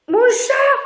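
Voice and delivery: sudden high loud exclamation; real startled shout at the cat; startled cry. Real, not acted. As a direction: caught off guard by the cat. Cat suddenly interrupts filming.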